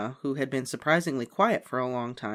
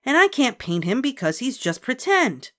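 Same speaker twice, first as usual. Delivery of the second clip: put-on whiny little girl; 11 semitones higher, 6 dB louder than usual. A narrator's reading. Child protests.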